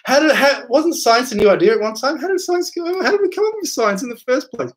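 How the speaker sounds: how could they not see it, rising high; exasperated rhetorical questioning; incredulous outburst. Real, not acted. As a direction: pitch leaps high in disbelief. Indignant rhetorical questions about science.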